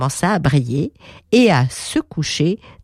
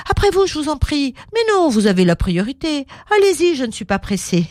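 polite drivers; 8 semitones higher, faster than usual. Acted.

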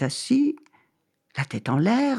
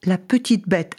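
ordinary delivery

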